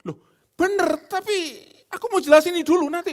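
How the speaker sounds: very high, loud hammed-up husband; mock indignant husband; husband arguing back. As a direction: push back in a squabble. Husband replies in quarrel.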